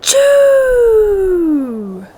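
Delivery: loud high achoo; huge sneeze. A narrator's reading. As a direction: let out a giant sneeze. Bear sneezes.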